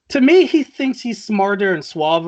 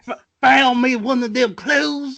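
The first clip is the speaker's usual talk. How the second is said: higher-pitched cartoony Southern drawl; hammed-up Southern detective, amused; 7 semitones higher, 3 dB louder than usual. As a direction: play a Southern caricature for laughs. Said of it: "southern drawl".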